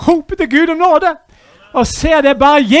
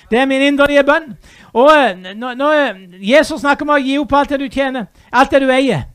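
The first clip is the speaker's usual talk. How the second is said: usual speaking voice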